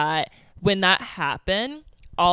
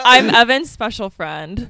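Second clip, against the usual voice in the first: louder, slow, laughing intro; too loud indoors; 4 semitones higher, 6 dB louder than usual. Real, not acted. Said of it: "She gotta use her outside voice".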